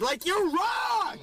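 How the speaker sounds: high, drawn-out complaint; slow, high-pitched indignation; exasperated. Acted. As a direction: whine it at someone obviously mistaken. Venting at the absent guard.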